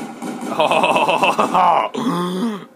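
long, loud whooping laugh rising in pitch; exaggerated "ho-ho-ha" cackle, drawn out; goofy laugh. Gamer cracking up at own silly talk, goofy laugh. Goofy outburst during play.

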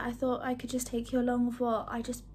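usual speaking voice

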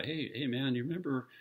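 high, earnest apology called down from the house. Retold or imitated. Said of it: "I yelled down".

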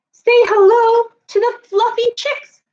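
high, slow storybook voice; sing-song it for little ones; bright picture-book read-aloud; reading to children. A narrator's reading.

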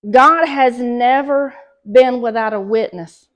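normal talking voice

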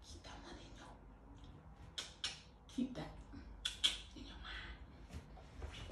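slow, hushed whisper. Pretend. Vlogger playfully repeating a tip to viewers, slow whisper. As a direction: whisper it slowly like a secret reminder.

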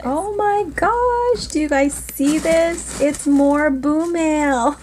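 high, slow thrilled gush; delighted squeal. Real, not acted. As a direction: gasp it out as if you can't believe it. Opening a surprise package.

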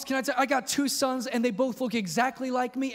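usual speaking voice

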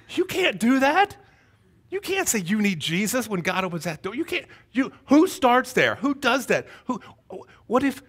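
high, aghast objection; incredulous protest. Retold or imitated. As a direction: protest to a friend, pitch jumping up. Retold objection to the friend.

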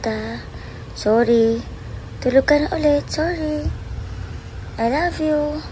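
murmuring lovingly to a sleepy dog; sweet pet talk. Real, not acted.

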